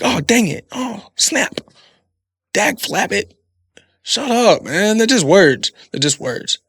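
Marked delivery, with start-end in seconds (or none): 0.0-3.3 high, silly mock-polite swearing; goofy clean cursing. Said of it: "it's like". 4.0-5.7 higher and louder, brushing it off; exasperated. Real, not acted. Dismissing the fuss.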